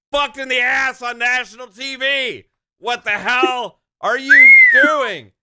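building to a loud, slow, exasperated outburst; angry rant. Real, not acted. Ranting about the Cowboys loss.

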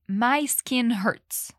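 My skin hurts said very slowly for learners; slow model line. Shown as a demonstration. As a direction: spell it out slowly, word by word. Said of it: "Agora repete".

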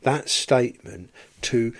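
normal talking voice

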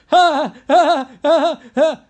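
loud, high, spaced-out "ha ha ha"; crazy mock laughter. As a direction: imitate a crazy woman's loud laughing fit. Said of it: "a crazy laughing woman who's laughing like".

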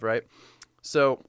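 normal talking voice

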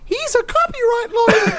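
very high squeaky cartoon mouse; Disney Mouse voice. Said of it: "been the Disney Mouse".

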